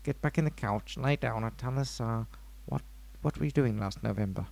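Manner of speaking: low, softened therapist voice; put-on psychiatrist voice. Host playing psychiatrist questions his co-host on the couch, low psychiatrist voice. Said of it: "What is that voice?".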